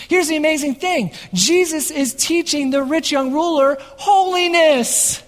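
high-energy pulpit delivery; fervent preaching. Acted. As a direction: preach it out to the whole room. Preaching at full force opening.

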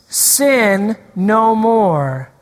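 drawn out, very slow, each word leaned on; slow call-and-response. Real, not acted. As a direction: lead the congregation word by word. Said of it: "say it with me".

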